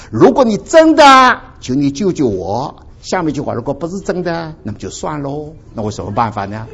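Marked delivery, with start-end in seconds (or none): 0.1-2.8 pleading cry. Retold or imitated. Pleading climax of retold prayer.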